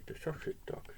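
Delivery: soft breathy whisper; whispered aside. Real, not acted. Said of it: "showing picture to child".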